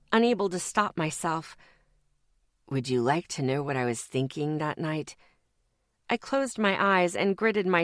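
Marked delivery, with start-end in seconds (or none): 2.7-5.2 a lower, quietly menacing father voice; a hint of a sinister father; lightly voiced demon father. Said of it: "using my father's voice".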